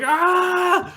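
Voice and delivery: high, loud mock scream; sudden high-pitched "ah" of retold horror; imitated scream. Woman seeing the boy's ugly face, mock scream. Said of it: "screams in his face".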